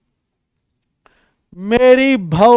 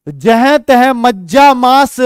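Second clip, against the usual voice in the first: slow, drawn-out chanting of the verse; poem recital; 3 semitones higher, 4 dB louder, slower than usual. Shown as a demonstration. Teacher slowly chants a verse line to students.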